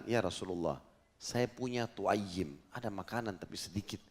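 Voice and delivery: hushed private plea; retold whisper. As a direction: tell it low, half-whispered, slowly. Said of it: "dibisikin Nabi sama dia, dia takut orang lain dengar".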